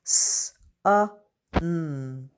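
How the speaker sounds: each letter sound said separately, with pauses between. Shown as a demonstration. Teacher to pupils, sounding out a word letter by letter. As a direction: split the word into its single sounds for the class. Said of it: "I'm gonna sound out".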